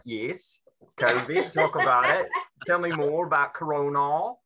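hammed-up copy of a London accent; mimicked London accent. Comic can't resist mimicking Londoners, exaggerated London accent. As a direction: parrot their London accent back at them. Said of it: "to just mimic them".

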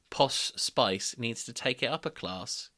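usual speaking voice

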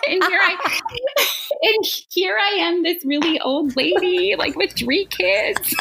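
high and giggly, laughing through the words; self-mocking laughter, pitched high. Real, not acted. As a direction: laugh at yourself as you say it. Laughing at herself.

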